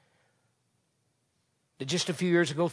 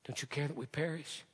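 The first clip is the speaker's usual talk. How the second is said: softer, pleading repeat; hushed echo; 8 dB quieter than usual. Retold or imitated. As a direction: say it quietly, plaintive. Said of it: "You can almost hear him".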